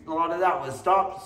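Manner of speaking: usual speaking voice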